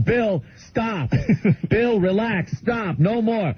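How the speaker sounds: high-pitched mock pleading to stop; imitated scolding parents. Parents telling their guest to stop eating, high mock scolding. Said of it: "they're telling him".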